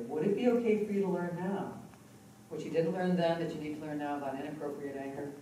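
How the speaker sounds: ordinary talk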